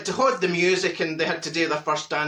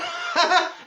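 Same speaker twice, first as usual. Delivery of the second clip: laughing; 11 semitones higher than usual. Real, not acted. Laughs at own joke.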